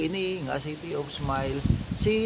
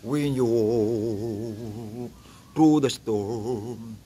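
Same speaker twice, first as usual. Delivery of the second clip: deep pitch shifted; 8 semitones lower, much slower than usual. Acted. Pitch-shifted sung line.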